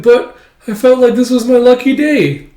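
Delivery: high, loud mock whine; whiny protest. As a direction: wail over lost luck. Beaten Frankie protests.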